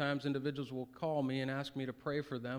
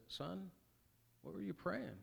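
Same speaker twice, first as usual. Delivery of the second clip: a hint of a hushed, higher father's voice; lightly voiced dad asking his son, hushed and higher; gentle dad; 6 semitones higher, 7 dB quieter than usual. Father quietly asking his son about his prayer, hushed.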